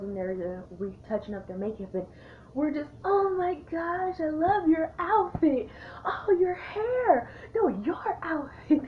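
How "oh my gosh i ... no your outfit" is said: very high, drawn-out squeal of admiration; hammed-up girly gushing, stretching the key words; gushing compliments. Tipsy girls complimenting each other in the bathroom, squealing gush.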